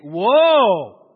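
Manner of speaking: "Woe" loud and drawn out; long, forceful warning cry; emphatic repeat. Shown as a demonstration. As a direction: boom out "Woe" for them to echo. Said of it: "say it again".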